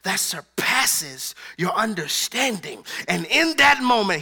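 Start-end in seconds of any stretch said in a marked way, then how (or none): 0.0-2.8 calm promise. Acted. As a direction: speak peace slowly and gently. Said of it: "he told us in his word".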